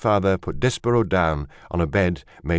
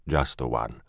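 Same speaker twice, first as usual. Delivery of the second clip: lower, softer, understated reply; subdued father; 6 semitones lower, 6 dB quieter than usual. A narrator's reading. Father mouse confirms one baby to his wife, quiet and low.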